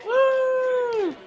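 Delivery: high, drawn-out cheer; imitated crowd "yay". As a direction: whoop like a delighted audience. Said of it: "everyone goes".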